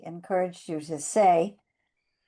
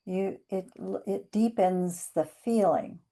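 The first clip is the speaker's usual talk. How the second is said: usual speaking voice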